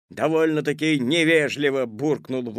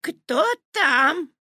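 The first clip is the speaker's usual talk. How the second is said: put-on high, loud old-lady call; grandmother's voice; 6 dB louder than usual. A narrator's reading. Grandmother answers Petya's knock, high calling voice. Grandmother calls through door.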